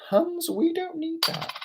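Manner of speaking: high cooing to pet; high petspeak. Real, not acted. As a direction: coo at the dog. Talking to pet.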